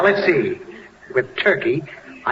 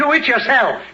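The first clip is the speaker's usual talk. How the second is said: put-on sharp, raised pitch, fed up with the kid; irritated father snaps; 10 semitones higher than usual. Father brushing off his daughter, irritated and sharp. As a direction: bark it back at the pesky child.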